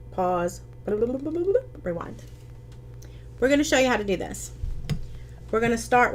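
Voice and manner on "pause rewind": slow, much softer, stretched-out rewind sound; mock tape rewind. Joking to viewers that the video rewinds, in a slow, soft mock-rewind voice. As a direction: drag it out like a tape slowing down.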